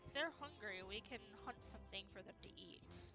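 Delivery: hushed, careful words; quiet. Acted. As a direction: say it as quietly as you can. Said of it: "I'm saying this quietly as I can".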